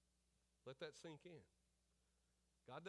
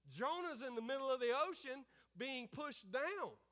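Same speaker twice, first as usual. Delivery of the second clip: loud, higher-pitched sermon delivery; full-force preaching; 6 semitones higher, 6 dB louder than usual. Real, not acted. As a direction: drive the point home to the congregation.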